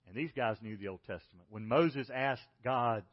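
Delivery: normal talking voice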